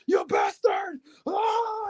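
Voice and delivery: very high shouted outrage; hammed-up wrestler charging down the ramp; mock angry shout. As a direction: scream at him in a hammed-up rage. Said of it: "he's just like".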